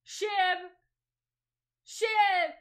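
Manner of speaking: calling out. Real, not acted. Streamer summoning the co-host, loud calling out. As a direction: call out sharply for a friend.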